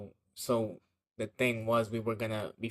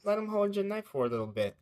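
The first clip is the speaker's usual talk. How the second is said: high, quick, sugary mom imitation; nice and polite; 10 semitones higher, faster than usual. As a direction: mimic a mom being overly polite in a raised voice. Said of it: "trying to be all nice and polite".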